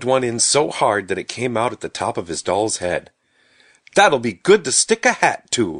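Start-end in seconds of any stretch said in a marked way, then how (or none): 3.9-5.8 put-on loud, raised, cheery child's voice; cheerful. A narrator's reading. Stubby pleased with his apple doll, speaking up cheerfully. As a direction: pipe up cheerfully, pleased with the idea. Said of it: "he said cheerfully".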